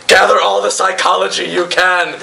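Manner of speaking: high, strained commander's cry; rally the troops in a mock scream. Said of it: "Ken is screaming".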